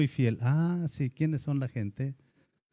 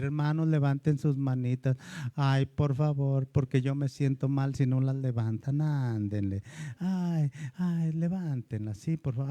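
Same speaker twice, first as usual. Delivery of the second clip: mock pleading. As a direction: plead wearily, as a needy worship leader. Worship leader begging for raised hands.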